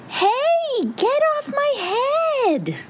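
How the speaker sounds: very high-pitched character squawk; high, indignant little pumpkin yell. A narrator's reading. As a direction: holler it high, stretching the words. Pumpkin yells at thing on head.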